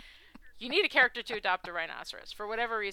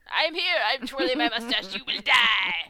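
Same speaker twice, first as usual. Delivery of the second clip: loud, hammy stage-villain voice; hissed villain threats, then louder; mustache twirling villain; 2 semitones higher, 10 dB louder than usual. Retold or imitated. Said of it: "The villains that just simply exist to twirl the mustache".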